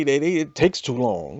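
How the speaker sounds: ordinary talk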